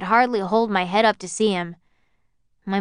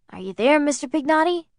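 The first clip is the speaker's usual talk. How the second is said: forced enthusiasm; 7 semitones higher than usual. Acted. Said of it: "artificial enthusiasm John had put into his voice".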